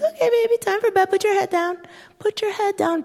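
a small daughter mothering her doll, sweet and high; little girl voice. Retold or imitated. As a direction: play the tiny mom tucking in her doll. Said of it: "And she goes".

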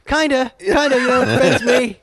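high, giggling through the words; retelling his own reply with a laugh. Retold or imitated. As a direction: laugh while admitting it offends.

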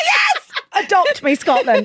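high, loud laughing cheer; long, whooping celebratory yell; real triumphant shout. Real, not acted. Celebrating her accent being approved.

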